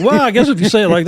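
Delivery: talking through laughter; amused at the memory; laughing. Real, not acted.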